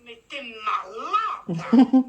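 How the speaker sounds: slow, crying-out disbelief; shocked outcry. Real, not acted. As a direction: react to the prank with a shocked yell. Friend shocked by prank call.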